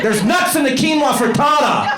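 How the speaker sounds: high-pitched town-crier yell; hammed-up shouted warning; Paul Revere. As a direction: sound the alarm in a mock holler. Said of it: "he was Paul Revere or something".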